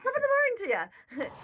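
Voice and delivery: very high, loud Irish brogue; Irish accent. Retold or imitated. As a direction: shout it in a cartoon Irish accent. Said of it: "my Irish accent".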